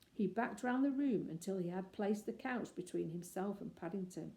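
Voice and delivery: plain reading voice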